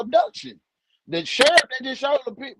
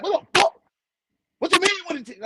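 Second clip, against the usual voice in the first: furious, disbelieving shout; imagined outburst; 8 semitones higher, faster than usual. Retold or imitated. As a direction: blow up at the detective. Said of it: "I was like".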